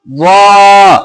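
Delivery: "वा" thick, with a long held rounded vowel; drawn-out वा. Shown as a demonstration. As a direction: stretch out "वा". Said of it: "खींच के पढ़ना है". (खींच के लंबा "वा")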